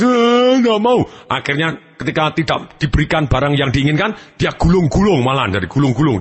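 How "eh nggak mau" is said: high mock wail of refusal; hammed-up kiddie crying; crying child. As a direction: bawl like a stubborn child. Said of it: "ketika dia nangis".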